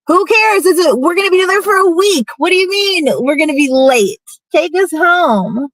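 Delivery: desperate, shrill pleading to be taken home; upset pleading. Retold or imitated. Pleading with him in the truck.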